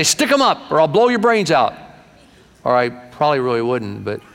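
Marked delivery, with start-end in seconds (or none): 0.0-1.7 high, loud stick-up growl; mock outlaw threat. Preacher mocking himself as a tough outlaw. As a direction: play a tough robber, hammed up. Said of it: "I couldn't make a good outlaw".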